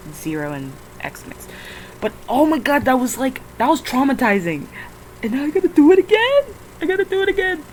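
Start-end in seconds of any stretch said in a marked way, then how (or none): 5.2-7.6 high, fast wailing complaint; high despairing wail; despairing whine. Real, not acted. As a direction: wail in exasperation. Despairing at redoing work.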